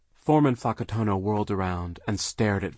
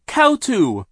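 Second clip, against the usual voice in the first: put-on very high baby shriek; 12 dB louder than usual. A narrator's reading. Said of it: "Sonny shrieked".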